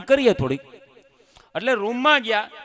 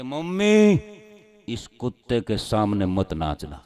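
Dharmendra impression; 6 semitones lower, slower than usual. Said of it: "લીધો ધર્મેન્દ્રનો role".

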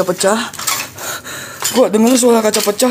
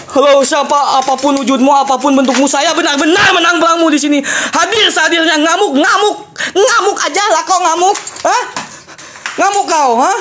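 raised, taunting call with laughs; mock challenge; 7 semitones higher than usual. Ghost hunter taunting spirits, raised and laughing. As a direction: dare the spirits, half laughing.

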